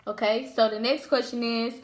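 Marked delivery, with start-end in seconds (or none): none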